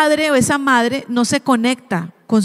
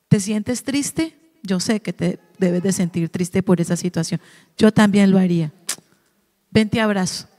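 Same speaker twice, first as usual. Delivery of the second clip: validating parent; 5 semitones lower, 3 dB quieter than usual. Shown as a demonstration. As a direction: comfort them gently and unhurried. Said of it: "Algo que le valide las emociones".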